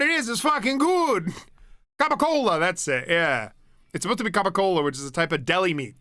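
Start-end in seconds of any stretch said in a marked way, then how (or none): none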